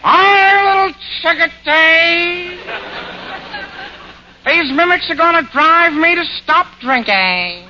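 high, drawling comic voice; slow nasal Fields drawl. Retold or imitated. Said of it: "here's what W C Fields has to say".

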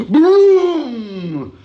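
a sudden high loud boom; mimicked car boom. Old man imitating a car roaring past. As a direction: blast it out like a speeding car.

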